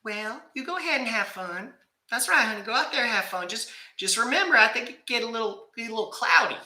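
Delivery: wife teasing her husband in a high mock voice; imitating wife. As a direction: play the wife sweetly brushing him off. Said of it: "You know what she tells me?".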